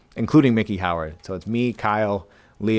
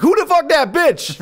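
high-pitched imitated yell from the back seat; mock shout, pitch leaping way up; retold drunken shout; 4 dB louder than usual. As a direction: yell it out, mimicking a tipsy diva. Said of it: "and she shouts".

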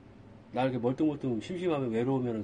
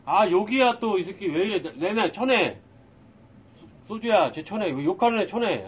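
loud, higher, exasperated scolding; irritated yelling; 5 semitones higher, 8 dB louder than usual. Real, not acted. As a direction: snap loudly at a troll in chat. Streamer yelling at swearing viewer.

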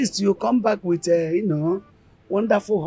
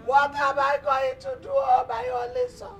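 crying. Acted. Mother laments her only son's arrest, wailing. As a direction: wail helplessly, stretching out the cries. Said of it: "You must stop crying".

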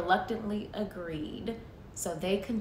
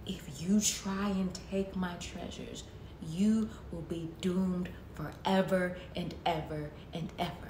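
ominous guardian; 3 semitones lower than usual. Acted. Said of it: "the voice said".

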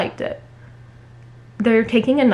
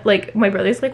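ordinary talk

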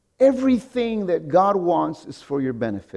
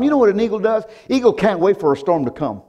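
normal talking voice

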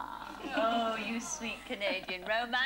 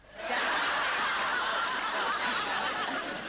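higher drawn-out laughter; long laugh; 7 semitones higher, 3 dB louder than usual. Real, not acted. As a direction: keep laughing at the joke. Laughing at the toilet seat.

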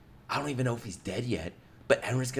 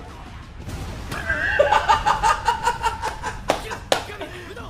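high cackling laughter, building louder; long wild laugh; 4 dB louder than usual. Real, not acted. Viewer overwhelmed by the carnage, helpless high laughter. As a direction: crack up into rapid high bursts.

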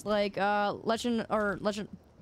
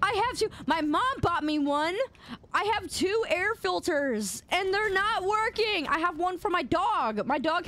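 excited anime fan; 7 semitones higher than usual. Real, not acted. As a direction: gush like a thrilled fan. Excited claim about air filters.

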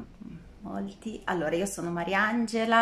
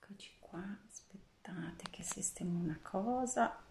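whisper; 8 dB quieter than usual. Real, not acted. Aside while fixing the setup.

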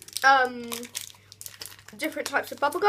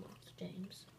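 breathy whisper, mock formal; 6 semitones lower, 24 dB quieter than usual. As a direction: whisper the title grandly. Mock formal address to brother.

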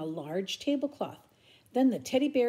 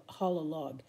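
ordinary delivery

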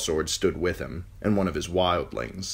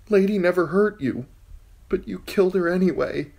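put-on higher, timid girl's voice; frightened girl; 13 semitones higher, 5 dB louder than usual. Captive girl answering the king, timid and high. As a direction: answer carefully, afraid of him.

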